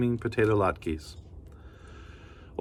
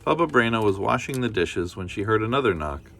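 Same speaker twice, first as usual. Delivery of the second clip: usual speaking voice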